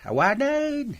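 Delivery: lightly voiced elderly father; old father. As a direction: play the fussy old father chatting.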